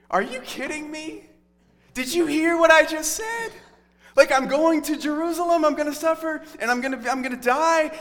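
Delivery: a hammed-up exasperated Jesus scolding; exasperated outburst.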